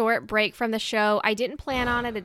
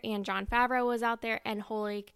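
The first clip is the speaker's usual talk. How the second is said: ordinary talk, nothing marked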